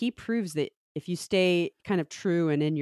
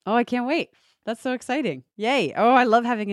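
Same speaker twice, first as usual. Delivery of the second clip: higher, bubbly delight; excited; 7 semitones higher, 4 dB louder than usual. Real, not acted. Told about a new podcast, replying with excited delight. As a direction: cheer like a new toy just arrived.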